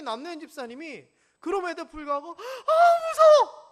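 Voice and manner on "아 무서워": high, loud, drawn-out frightened squeal; mock scared squeal. Wife squeals at a tiny leashed dog, hammed-up fear. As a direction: ham up a wife's fright.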